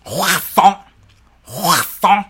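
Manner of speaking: "croissant" with a drawn-out scraped r, like spitting; throaty spitting croissant. Shown as a demonstration. Showing why the word is funny, spitty "croissant". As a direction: hawk the r in "croissant", then land the end. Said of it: "like spit on someone".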